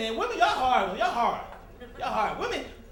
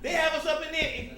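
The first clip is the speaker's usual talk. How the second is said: normal talking voice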